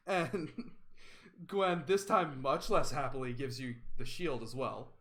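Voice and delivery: ordinary delivery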